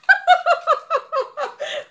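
shrill high cackling laugh; high cackle. Real, not acted. Laughing after taunt.

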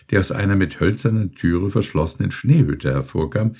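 plain reading voice

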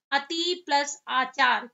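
teacher dictating each piece slowly; slow split. Shown as a demonstration. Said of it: "संधि विच्छेद किया तो क्या हो गया".

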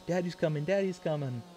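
put-on playful urgent chant of a hiding toddler; high, repeated warning in a child's voice; hiding daughter. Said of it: "hide her head into her, and she'll just, like".

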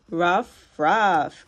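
low woofy ruff ruff; dog bark. Pretend. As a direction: bark like a friendly puppy. Mimicking a dog's bark.

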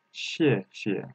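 "谢谢" falling first, then light and low; relaxed second syllable. Shown as a demonstration. Teacher shows the toneless syllable, falling then relaxed "谢谢". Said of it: "The second 谢 is we relaxed".

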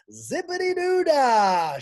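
very high, loud, slow cheer; sing-shout in glee. Acted. Missus Drizzle cheering, high loud slow shout. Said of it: "shouted Missus Drizzle".

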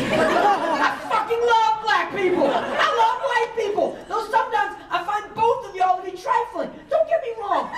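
laughing, over-the-top insistence; laughing emphatic outburst. Acted. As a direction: blurt it out, cracking up. Insistent outburst after race joke.

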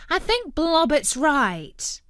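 bright, proper cartoon dog; polite know-it-all dog; Future Dog voice. A narrator's reading.

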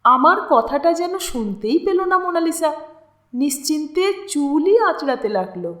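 usual speaking voice

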